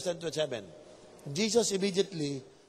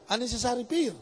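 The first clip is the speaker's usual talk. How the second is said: normal talking voice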